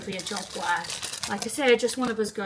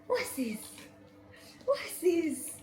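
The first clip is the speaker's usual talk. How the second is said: dog voice; 7 semitones higher, 7 dB quieter, slower than usual. Real, not acted. Talking to the puppy.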